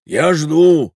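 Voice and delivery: loud, insistent demand; impatient demand. Acted. Tall stranger demanding an explanation, loud and impatient. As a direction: insist loudly on an answer.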